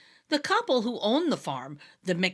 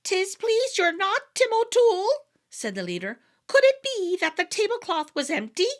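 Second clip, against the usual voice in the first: much higher mocking wee-folk voice; teasing fairy; 10 semitones higher, 4 dB louder than usual. A narrator's reading. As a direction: needle him with mock innocence. Grinning fairy leader teases Tim.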